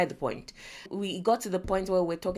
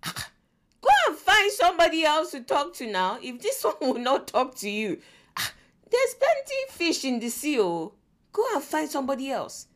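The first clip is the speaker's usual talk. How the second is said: brushing it off, higher and louder; dismissive mom; 5 semitones higher, 3 dB louder than usual. Retold or imitated. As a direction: wave the drama away, mock impatient. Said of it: "I'll be like".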